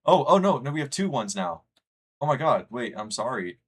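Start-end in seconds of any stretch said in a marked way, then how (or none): 0.0-1.6 much higher and louder, caught off guard; startled oh no. Real, not acted. Streamer sees the poll flip, loud startled cry. Vote count flips suddenly. 2.2-2.7 higher and louder exasperated exclamation; exclaiming. Real, not acted. Streamer realizes the wrong count, loud exclamation. Reacting to vote mix-up.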